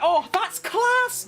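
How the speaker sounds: high, slow, overdone Scouse; too-scouse impression. Shown as a demonstration. Streamer attempting a meme impression for a viewer, exaggerated Scouse. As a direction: put on a drawn-out, high-pitched Scouse meme voice. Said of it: "No, that's too scouse".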